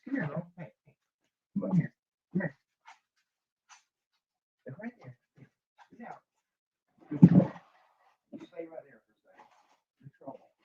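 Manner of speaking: high, coaxing call to a pet, off-mic; talking to the dog. Real, not acted. Calling the dog off boxes.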